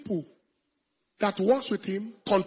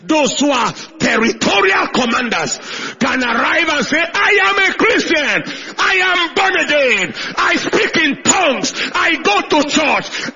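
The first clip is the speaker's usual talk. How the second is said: loud, higher proclaiming shout; preaching at full force; 6 semitones higher, 7 dB louder than usual. Acted. Preacher shouting a bold confession of faith. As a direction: declare it boldly with no apology.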